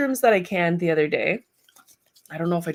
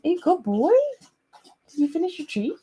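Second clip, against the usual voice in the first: high, sing-song praise for the dog; puppy talk; 11 semitones higher than usual. Real, not acted. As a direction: coo at a big puppy. Talking to her puppy.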